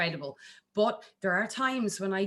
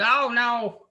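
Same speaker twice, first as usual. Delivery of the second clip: loud, higher mock dismissal; dismissive shoot-down; 5 semitones higher, 7 dB louder than usual. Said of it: "shooting it down like we do in Ireland".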